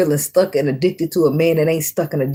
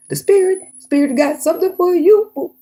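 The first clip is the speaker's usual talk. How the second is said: mock announcement. Playful spirit announcement.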